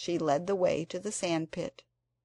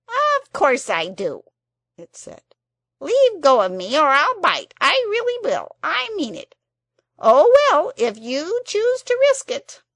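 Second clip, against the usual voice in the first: much higher, loud cross creature; threatening Psammead; 10 semitones higher, 14 dB louder than usual. A narrator's reading. The Psammead threatens to bite Anthea if held. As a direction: bluster a threat you half mean.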